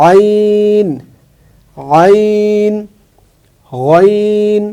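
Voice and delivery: আইন and গইন held long and high; teacher reciting letters; letter review demo. Shown as a demonstration. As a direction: stretch each letter slowly. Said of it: "বলে আসি".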